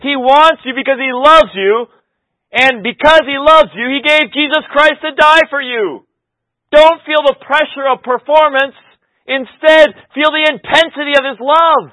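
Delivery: loud, high, driving sermon voice; full-force preaching. Acted.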